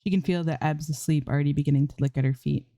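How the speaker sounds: ordinary delivery, nothing marked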